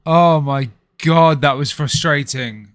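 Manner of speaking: loud, drawn-out groan of frustration; exasperated outburst. Real, not acted. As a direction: let the built-up tech rage spill out. Streamer fixing broken camera.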